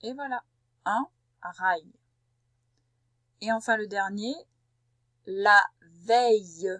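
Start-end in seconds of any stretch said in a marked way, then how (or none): 5.3-6.7 "la veille" slow and loud, each sound drawn out; slow dictation. Shown as a demonstration. Teacher dictating "la veille" to a pupil, slow and loud. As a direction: stretch "la veille" slowly for writing. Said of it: "J'ai bien dit la veille".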